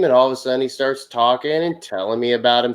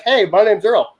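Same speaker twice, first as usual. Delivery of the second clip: Earl; 8 semitones higher than usual. Retold or imitated. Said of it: "Earl would be like".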